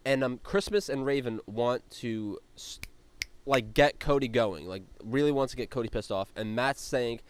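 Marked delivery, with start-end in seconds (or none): none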